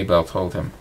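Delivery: plain reading voice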